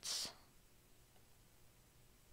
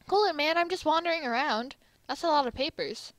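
lightly voiced, higher, casual America; breezy America; 7 semitones higher, 4 dB louder than usual. America tells a busy colleague to cool it, breezy. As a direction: play it as a carefree dude wandering by.

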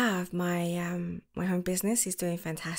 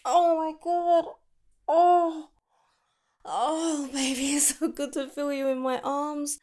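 tender relief; 6 semitones higher, 5 dB louder, slower than usual. Acted. Holding the lover after reunion.

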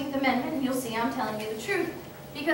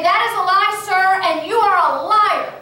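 raised voice denouncing the senator; angry retort; 5 semitones higher, 6 dB louder than usual. Retold or imitated. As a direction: call him a liar to his face.

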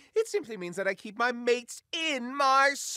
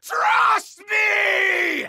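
screaming; 9 semitones higher, 4 dB louder than usual. Acted. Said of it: "Rick screamed".

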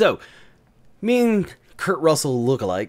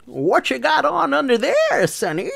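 put-on high, teasing old-lady voice; flirty grandma; 12 semitones higher, slower than usual. Grandma asking the kilted man what's under there, teasing. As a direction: play a cheeky old lady fishing for a peek. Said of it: "she's like".

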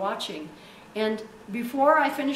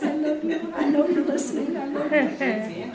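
put-on Texas accent; 5 semitones higher, 5 dB louder than usual. Preacher imitating a woman in Texas, Texas accent. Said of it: "so she sounds like this".

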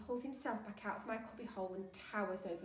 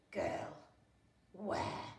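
rough, stony rasp; granite-scraping voice; 3 semitones lower than usual. A narrator's reading. Looming sailor hunting the girl, stony rasp. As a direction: scrape the words out like wet granite. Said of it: "voice that sounds like two slabs of wet granite scraping".

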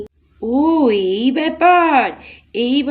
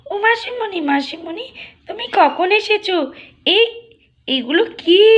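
put-on high excited child's voice; a small girl delighted by presents; little girl voice; 5 semitones higher than usual. Little girl asking her aunt about gifts.

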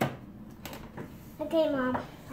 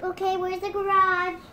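ordinary delivery

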